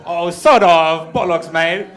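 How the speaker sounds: slow, loud mock British accent. Comic mocking the British in a loud British accent. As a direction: ham up a cockney Brit. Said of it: "British ass".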